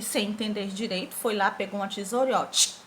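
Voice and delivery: normal talking voice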